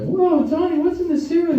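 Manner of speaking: put-on squeaky high child voice; shrill happy kid answering. Kids answering Tony the Tiger, very high.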